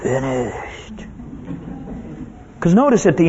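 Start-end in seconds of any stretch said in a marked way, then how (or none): 0.0-1.0 soft, spent dying breath; weak gasp. Shown as a demonstration. Preacher showing how Jesus did not say it, weak gasp. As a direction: let it out faintly on failing breath. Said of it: "it wasn't".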